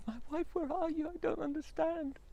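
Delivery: high husband voice, lost; bewildered husband. Acted. Husband asking for his missing wife, high and lost. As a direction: plead into the empty house.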